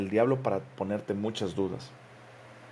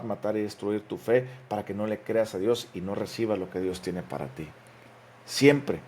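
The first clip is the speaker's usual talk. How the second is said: ordinary talk, nothing marked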